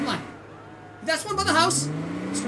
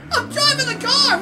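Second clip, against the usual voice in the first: high, loud, gleeful cry; excited shout; 5 semitones higher, 7 dB louder than usual. Real, not acted. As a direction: exclaim it in thrilled amazement. Thrilled to be driving.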